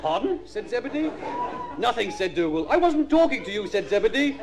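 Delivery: put-on high-pitched kids' TV storyteller; mock children's story narration. Comedian reading a Magic Roundabout script, childlike storytelling voice. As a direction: read it high and simple, as if to little children.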